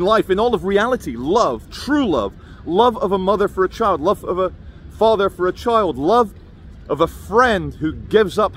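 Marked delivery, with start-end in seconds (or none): none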